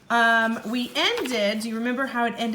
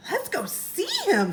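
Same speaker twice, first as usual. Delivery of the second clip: 1 eager; 11 semitones higher than usual. Acted. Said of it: "said Annie".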